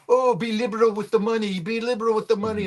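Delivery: lightly voiced French side begging to spend; mock French voice. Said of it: "The French are going".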